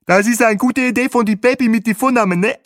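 high, loud chirpy guide; squawking Bahe. A narrator's reading. Bahe squawks into his headset, high. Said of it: "quäkte Bahe".

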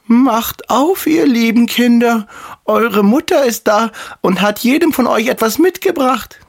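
put-on the wolf squeaks sweetly to fool the kids; fine wolf voice. Wolf after eating chalk fakes mother's voice, high and sweet. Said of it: "machte damit seine Stimme fein".

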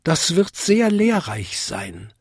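high-pitched tiny bell character; tinkling little bell. A narrator's reading. Smallest bell politely answering the biggest, high and tinkling. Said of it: "bimmelte Vinzi".

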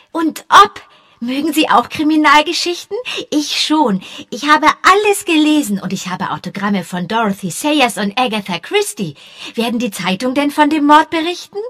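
high, eager schoolboy chatter; excited boy. A narrator's reading. Young boy chattering eagerly to the policemen. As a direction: babble on like an excited kid.

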